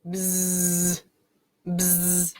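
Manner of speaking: long, soft, steady buzzing; bee buzz. Pretend. Baby insect answers its dad in a picture book, steady buzz. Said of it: "And his baby says".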